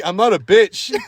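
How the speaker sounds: high, loud imitated comeback; retold defiance. Storyteller as dad standing up for himself, mock defiant comeback. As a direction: throw it back with mock bravado.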